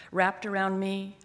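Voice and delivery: usual speaking voice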